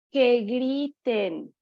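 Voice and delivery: drawn-out, deliberate "que griten"; slow emphasis. Real, not acted. Speaker urging parents to let boys scream, slow and deliberate. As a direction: stretch "que griten" slowly for weight.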